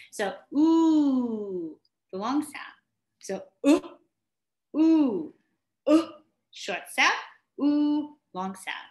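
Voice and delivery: "ooh" said high against a short "uh"; teacher contrasting the two sounds; ooh versus uh. Shown as a demonstration.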